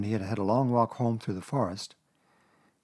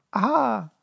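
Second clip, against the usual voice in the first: high little attempt at triumph; shaken Piglet's aha; 12 semitones higher, 4 dB louder than usual. A narrator's reading. Piglet after his terrifying journey, high attempted aha. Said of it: "as well as he could after his terrifying journey".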